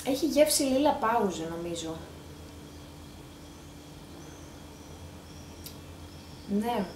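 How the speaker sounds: between licks of ice cream; with cold ice cream in mouth; talking while eating ice cream. Real, not acted. Seen 0: She holds the cone while talking and licks it at 1.8.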